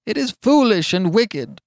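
alarmed objection; faltering. A narrator's reading. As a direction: protest as nerve gives way. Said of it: "he faltered".

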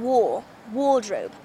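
"war. Wardrobe" with the first R dropped. Shown as a demonstration. Coach showing English wardrobe, R dropped in "war. Wardrobe". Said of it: "don't pronounce the R right".

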